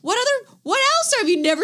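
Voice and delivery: high, disbelieving question; high, mock outraged disbelief; incredulous. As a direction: quiz him like he's a stranger. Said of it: "I was like".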